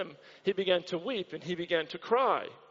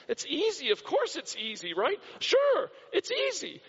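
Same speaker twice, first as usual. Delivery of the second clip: high-pitched, glib "oh sure"; breezy mock agreement; 7 semitones higher than usual. Preacher mimicking churchgoers who find amen easy, glib mock agreement. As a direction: toss it off in an exaggerated sing-song of easy agreement.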